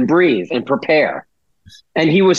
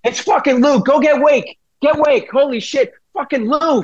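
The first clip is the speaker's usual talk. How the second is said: giddy infielder yelling for Wake; excited Pedroia; 12 semitones higher than usual. Retold or imitated. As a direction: holler for the teammates to come see. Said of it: "every word out of his mouth was fucking".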